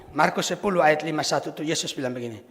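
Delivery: usual speaking voice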